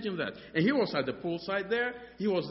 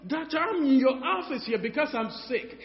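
high insistent complaint; 8 semitones higher than usual. Retold or imitated. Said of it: "retold insistence to doctor".

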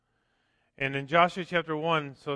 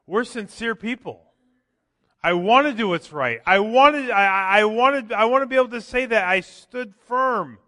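loud, higher, impassioned sermon voice; full-force preaching; 7 semitones higher, 10 dB louder than usual. Acted. Pastor urging the congregation, loud and impassioned. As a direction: preach it with rising conviction.

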